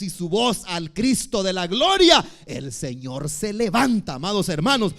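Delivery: loud, rising sermon cry; fiery preaching. Acted. Pastor exhorting his congregation, in a loud fiery preaching voice. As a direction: preach it out to the whole church.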